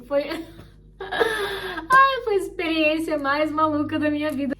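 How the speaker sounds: laughing through it. Real, not acted. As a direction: let the laugh break into the line. Ri ao lembrar a entrevista. (rindo ao lembrar da entrevista)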